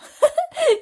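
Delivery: quick high laugh; real laugh. Real, not acted. Narrator laughs mid-read.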